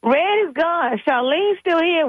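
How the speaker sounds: put-on high, childlike worried voice; a little girl asking grandma what's wrong; imitating daughter. Granddaughter asks what's wrong, high childlike voice. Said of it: "My daughter's like".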